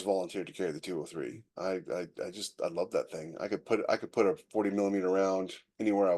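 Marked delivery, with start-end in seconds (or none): none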